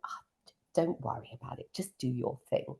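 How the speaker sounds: an imitated mentor's quick whisper of comfort; hushed reassurance. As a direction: lean in and whisper it kindly. Jonty quoted reassuring her.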